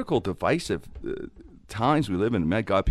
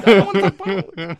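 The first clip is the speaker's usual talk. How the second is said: exasperated retort; 11 semitones higher, 7 dB louder, faster than usual. Retold or imitated. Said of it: "I'm like".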